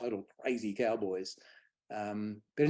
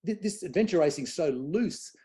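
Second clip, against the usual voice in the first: retold shock; 6 semitones higher, 3 dB louder than usual. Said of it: "shocked and going".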